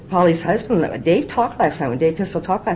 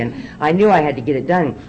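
usual speaking voice

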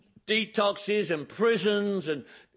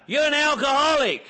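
much higher and louder, yelling; loud shouted accusation; 6 semitones higher, 6 dB louder than usual. Retold or imitated. Man at the meeting yelling his verdict in his face. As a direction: hammer the word home at full volume.

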